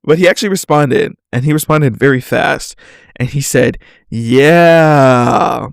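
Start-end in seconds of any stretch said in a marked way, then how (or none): 4.1-5.7 "Yeah" stretched long and loud-ish; long, swelling "Yeah"; drawn-out yeah. Retold or imitated. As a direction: hold the vowel in "Yeah" as if typed with extra letters. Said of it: "with, like, a bunch of A's".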